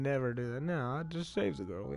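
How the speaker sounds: ordinary delivery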